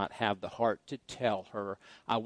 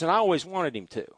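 ordinary talk

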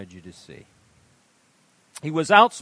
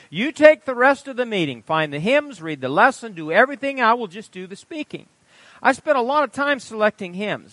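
animated sermon reading; 3 semitones higher, 6 dB louder than usual. Acted. Preacher reading a testimony aloud.

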